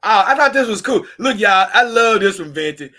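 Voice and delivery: loud and high with delight; excited. Real, not acted. Showing viewers a tweet he loves, excitedly loud. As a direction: call the viewers over, thrilled.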